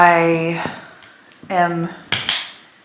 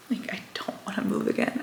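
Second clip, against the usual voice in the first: a fast, tired, real whisper; weary whisper; 2 semitones higher, 15 dB quieter, faster than usual. Real, not acted. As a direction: barely voice it, worn out. Weary about moving again.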